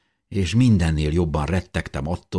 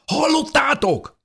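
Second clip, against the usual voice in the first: very high, loud, drawn-out cry; excited shout; 5 dB louder than usual. A narrator's reading. Dávid runs in with the news, shouting excitedly. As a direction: burst out with urgent news, pitched right up. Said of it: "kiáltotta Dávid izgatottan".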